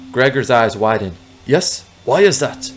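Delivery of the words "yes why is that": high, curious fake Russian accent; bad Russian accent. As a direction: press for an answer in mock Russian. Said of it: "that is a very bad Russian accent".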